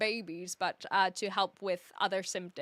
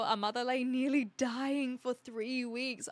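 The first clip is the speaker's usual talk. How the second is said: ordinary talk, nothing marked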